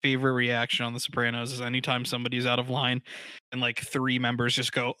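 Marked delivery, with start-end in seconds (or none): none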